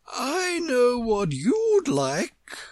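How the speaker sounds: high, slow, friendly queen; good-natured. A narrator's reading. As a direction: offer it kindly and grandly. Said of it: "the Queen said good-naturedly".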